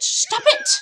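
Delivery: sudden very high, loud yelp of annoyance; real scolding. Real, not acted. Vlogger to the cat licking her mid-sentence, scolding. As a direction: burst out sharply at a pet, pitch shooting up.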